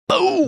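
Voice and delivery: high, drawn-out boom; imitated crash. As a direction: mimic heavy heads crashing down with a boom. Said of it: "all the heads came down and, like".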